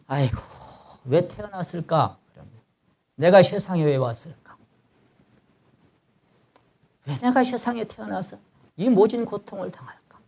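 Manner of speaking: soft, acted cry of despair; anguished lament. Preacher voicing a sufferer who curses being born, soft anguished lament. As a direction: lament under your breath, pitch climbing toward the end. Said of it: "이렇게 부르짖습니다".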